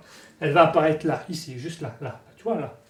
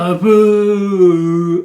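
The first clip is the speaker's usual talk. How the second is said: loud, very long-held syllable; drawn-out words; 7 dB louder than usual. Acted. Explaining improv to viewers, drawn-out and loud. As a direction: stretch it out louder and longer.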